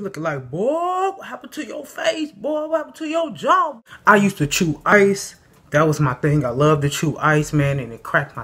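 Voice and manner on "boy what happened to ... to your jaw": mocking shout. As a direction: call it out like a heckler. People mocking his swollen face.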